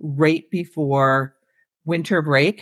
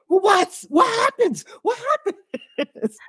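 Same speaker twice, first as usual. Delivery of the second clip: high-pitched imitation of kids dying to know; excited kids. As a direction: mock-desperate children begging for the ending. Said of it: "the kids are all like".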